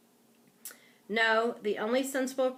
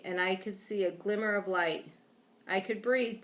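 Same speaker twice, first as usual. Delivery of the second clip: ordinary delivery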